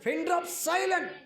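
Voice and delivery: high, loud proclamation; emphatic shout. Acted. Pastor urging the congregation, high loud call. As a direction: ring it out high over the crowd. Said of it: "ఎట్టుండాలి ప్రజలు?".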